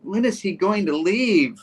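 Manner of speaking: put-on high, slow little-girl whine; bratty kid voice. Daughter interrupting the adults at dinner, high slow childish whine. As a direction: play the rude daughter, drawn out. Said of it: "She's like".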